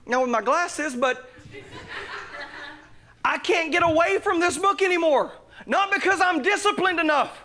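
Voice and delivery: high, rising pulpit shout; impassioned preaching. Real, not acted. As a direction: preach it with building fervour. Preaching at full force.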